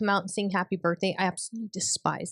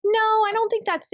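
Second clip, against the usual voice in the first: incredulous; 12 semitones higher, 5 dB louder than usual. Real, not acted. Protesting mother's teasing.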